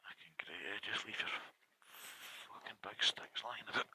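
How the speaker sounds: muttering under the breath; whispered grumble. Real, not acted. Vlogger whispering a grumble at the statue.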